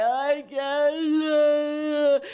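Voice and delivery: high, loud tearful cry; imitating herself sobbing on the exam table. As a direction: sob out the words. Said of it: "like, crying".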